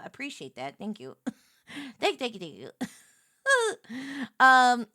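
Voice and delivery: ordinary delivery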